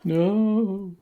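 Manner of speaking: high, drawn-out mock "no"; hammed-up horror, pitched well up. Cohost sends up the glass drop trope, mock horror.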